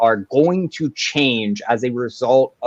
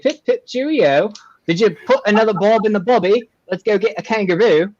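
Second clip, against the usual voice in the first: high mock English sliding into Australian; botched English-Australian accent, pitched up; English drifting Australian; 7 semitones higher than usual. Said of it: "I'll be doing an English accent".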